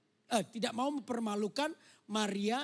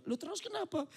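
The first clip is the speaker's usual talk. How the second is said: higher, bewildered question; puzzled pressing; 9 semitones higher than usual. Acted. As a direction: push her for the reason. Joseph presses Mary.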